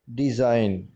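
डिज़ाइन said with a z sound and no g; डिज़ाइन: s like z, g dropped; silent g, s as z. Shown as a demonstration. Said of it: "एस को हम pronounce करेंगे जेड के sound में". (डिज़ाइन में जी silent, एस को ज़ेड की तरह)

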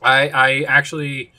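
speaking between bites; talking while chewing. Real, not acted. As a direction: mouth partly full of chocolate. Seen 0: Bitten chocolate bar in hand; cheeks bulge, jaw chewing between words.

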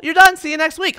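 high, loud sudden dismissal; brisk teacher shout. Retold or imitated. Swim teacher ending the lesson, loud brisk call. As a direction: snap the class shut. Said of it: "Blows the whistle".

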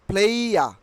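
"প্লেয়া" with gliding vowel and silent R; correct pronunciation. Shown as a demonstration. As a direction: say "প্লেয়া" as "phlei-ya". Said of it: "কি উচ্চারণ হবে". ("প্লেয়া", শেষে র ছাড়া)

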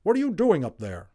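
mother calling from the room below; calling upstairs. A narrator's reading. Omri's mother calls up from below, high and loud. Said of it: "a voice from the room below".

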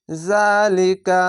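জালিকা recited very slowly, the লা held one alif long; Quranic recitation. Shown as a demonstration. Teacher models a word for Quran readers, stretched. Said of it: "খাড়া জবর এক আলিফ টেনে পড়বেন".

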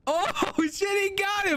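high-pitched cry of alarm; panicked high exclamation. Pretend. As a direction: yelp the news, voice shooting up. Officer sees the chief attacked.

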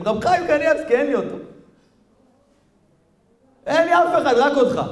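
high, anguished shouted prayer; wailing plea at the top of the voice; screaming to God. Acted. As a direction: act out a desperate man crying out to heaven. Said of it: "התחיל לצעוק".

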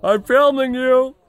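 high, loud playful call across the yard. Real, not acted. Calling across the property to his wife, high and loud. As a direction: shout it up to her, teasing.

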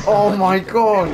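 excited shout. Real, not acted. Shocked outburst during gameplay.

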